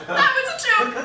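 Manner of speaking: high, hammed-up crying voice; mock tearful laughing. Maid of honor explaining her joke to guests, mock sobbing. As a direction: squeak it out between laughing sobs. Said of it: "I laughed and I was like".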